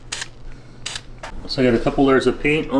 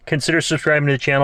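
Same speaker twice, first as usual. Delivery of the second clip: normal talking voice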